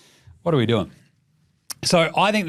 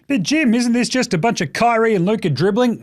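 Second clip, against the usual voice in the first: slightly higher, put-on questioner voice; imagined heckler's question; 6 semitones higher than usual. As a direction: lightly mimic a doubting listener. Voices a listener's objection.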